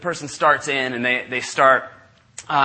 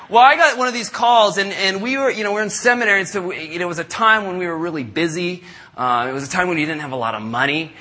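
ordinary talk

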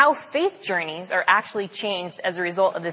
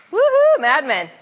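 cheer; 7 semitones higher, 6 dB louder than usual. Real, not acted. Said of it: "cheering for the show".

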